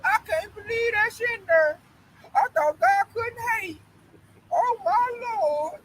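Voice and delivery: high, slow, wailing disbelief; imitating a distressed, bewildered grandmother; confounded grandma. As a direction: lament it in a drawn-out mock grandma voice. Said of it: "got confounded".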